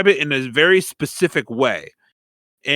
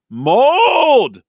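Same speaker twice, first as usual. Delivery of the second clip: loud shout; 11 semitones higher, 7 dB louder than usual. Retold or imitated. Friend Brett yells at the group, loud shout. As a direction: shout the word mold, high and loud. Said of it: "Really fucking loud".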